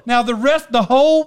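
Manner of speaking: ordinary talk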